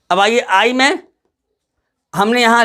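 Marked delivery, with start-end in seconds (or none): none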